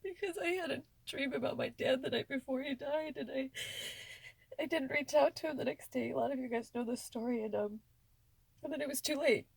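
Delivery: fighting back tears while telling it; real crying, words pushed out; tearful. Real, not acted. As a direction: voice high and breaking with tears. Recounting dad's death, tearful.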